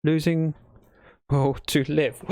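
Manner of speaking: ordinary talk, nothing marked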